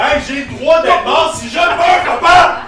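defiant shout. Acted. The son shouts back at his dad, defiant.

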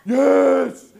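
laughing, then a loud high yes; eager yes. Retold or imitated. Preacher voicing himself answering God, laughing then eager. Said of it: "I'm like".

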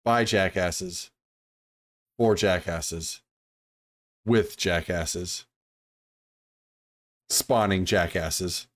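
slow deliberate list. Acted.

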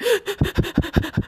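sharp high gasp, hammed up; a dramatic gasp of mock despair. Mocking her own moody self with a gasp. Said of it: "I'm like".

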